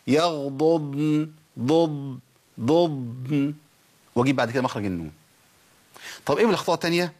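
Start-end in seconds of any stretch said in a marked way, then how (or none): 0.1-3.5 يغضضن very slow, second ض held still and long; الضادين واضحتين. Shown as a demonstration. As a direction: stretch يغضضن so both Dads stand apart. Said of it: "أبين الفرق بينهم".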